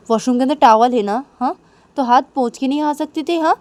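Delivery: annoyed scolding. Acted. Manisha scolding Nisha over wiping wet hands, annoyed. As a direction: tell them off indignantly.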